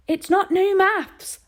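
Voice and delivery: high, loud, slow shout. A narrator's reading. Boy shouting at his mother over the sums, loud. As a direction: yell the correction. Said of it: "Harvey shouted".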